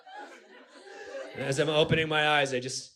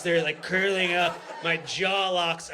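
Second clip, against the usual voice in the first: normal talking voice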